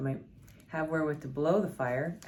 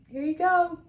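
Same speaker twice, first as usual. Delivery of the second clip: bright high-pitched friendly offer, much louder; friend handing flowers; 9 dB louder than usual. Retold or imitated. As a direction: chirp it high and loud like a cheerful friend at the door. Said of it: "And she's like".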